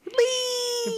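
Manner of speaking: excited squeal. Real, not acted. Excited shout of friend's name.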